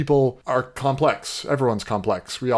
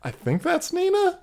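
high, uncertain guess; high unsure. Retold or imitated. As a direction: pitch it up, unsure. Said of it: "and I'm like".

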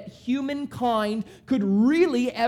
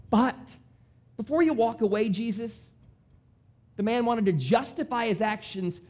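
normal talking voice

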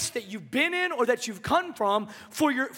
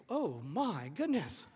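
stunned Mary; 9 semitones lower, 9 dB quieter, slower than usual. Retold or imitated. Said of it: "Mary's like".

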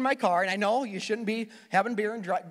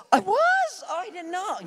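much higher, louder fake shock; mock surprise; 12 semitones higher, 4 dB louder, slower than usual. Driver feigning astonishment to the cop, much higher. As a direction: act scandalized and innocent.

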